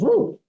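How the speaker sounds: high yelping howl of a hurt dog. Retold or imitated. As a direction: yelp it out like a hound in pain. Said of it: "and the dog goes".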